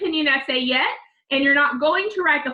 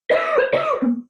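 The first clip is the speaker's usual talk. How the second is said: sudden real cough, high and strained; choking cough. Real, not acted. Teacher choking mid-lesson, real sudden cough. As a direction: cough as the throat catches. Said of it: "I got choked up".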